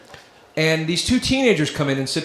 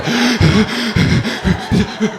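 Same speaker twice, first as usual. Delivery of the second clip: mock-scared gasp; panicked gasp; 5 semitones higher than usual. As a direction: gasp like a traumatized kid. Kids panicking at a puppet.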